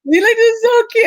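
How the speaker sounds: laughing gush. Real, not acted. Right after a big laugh.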